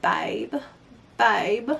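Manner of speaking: soft imitation of a British accent; playful, lightly put-on British accent. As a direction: softly try out a British accent. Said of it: "I just love the way they be like".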